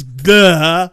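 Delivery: a short, loud mock grunt for the car landing; imitated crash grunt. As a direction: bark out a cartoon crash noise. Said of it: "like this".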